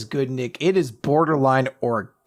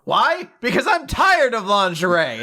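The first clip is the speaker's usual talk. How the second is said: higher-pitched, breezily upbeat quote; 7 semitones higher than usual. Retold or imitated. Woman cheerfully explaining why she passed it on, upbeat. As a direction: sound chipper and unbothered, pitch lifted. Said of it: "she's very upbeat about this".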